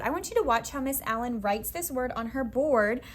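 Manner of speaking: usual speaking voice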